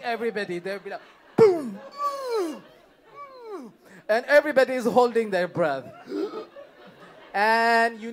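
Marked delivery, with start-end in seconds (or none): 1.4-3.7 higher, louder punchy booms; mock fight sound effects; punch sounds. The smell punching everybody. 5.9-7.3 sharp high breathless gasp; hammed-up breath-holding gasp. Everybody holding their breath.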